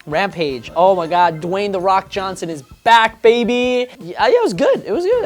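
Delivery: normal talking voice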